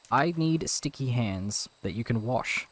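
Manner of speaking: usual speaking voice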